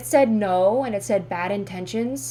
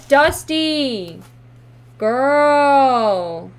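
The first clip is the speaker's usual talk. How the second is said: loud, high, drawn-out exclamation; loud outcry; 4 semitones higher, 9 dB louder, much slower than usual. Acted. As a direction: call out the verdict, stretching it loud. Calls out the card's verdict.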